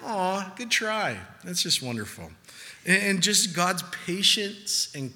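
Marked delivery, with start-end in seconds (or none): 0.0-1.2 high mock cooing consolation; loving indulgence. Jesus consoling a clueless asker, imitated with high loving indulgence. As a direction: coo it as if to a small child. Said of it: "Jesus just so lovingly".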